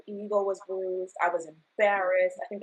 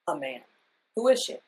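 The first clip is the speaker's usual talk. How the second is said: ordinary talk, nothing marked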